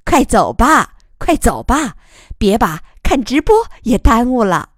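loud, bright hurry-up call; cheerful urging. Acted. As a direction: rush everyone along, laughing. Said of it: "于乐水笑着催大家".

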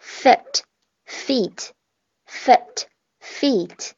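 very slow, careful fit and feet, short i then long ee; slow contrast of fit and feet; short vs long vowel demo. Shown as a demonstration. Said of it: "大家感受一下这个短音长音的区别".

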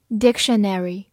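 "dictionary" the American way, with a full "-nary" ending; American pronunciation. Shown as a demonstration. Teacher showing learners the US variant, American pronunciation of "dictionary". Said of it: "美式发音".

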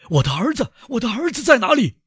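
high, desperate demand; panicked father, pitch soaring; frantic. A narrator's reading. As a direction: demand to know where your child is. Frantic father searching for his son.

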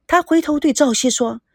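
ordinary delivery

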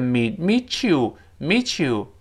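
"meet you" as "meechoo"; linked ch sound. Shown as a demonstration. Teacher demonstrating sound change, "meet you" linked with ch. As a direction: slowly blend "meet you" through a ch. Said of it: "变成meet".

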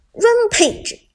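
booming announcer call; game announcer. Acted. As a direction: hype kill announcer voice.

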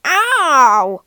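loud drawn-out cry of pain; loud, falling "ow"; snorted yelp. A narrator's reading. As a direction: yelp from a stinging trunk. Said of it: "he snorted".

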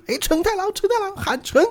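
high call across the street. Retold or imitated. Bobo calling out to Jotaro for help, high. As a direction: hail a friend from afar. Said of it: "他就看到承太郎就喊".

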